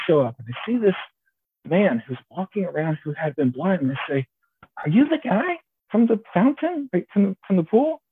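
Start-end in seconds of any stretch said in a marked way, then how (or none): 4.8-8.0 questioning Pharisee. Acted. Pharisees questioning the healed man.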